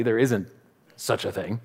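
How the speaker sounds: normal talking voice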